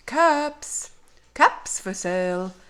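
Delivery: high singsong street cry; peddler's call. A narrator's reading. Peddler crying caps to the town, calling out. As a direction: call out wares, ending high on the price. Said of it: "he called".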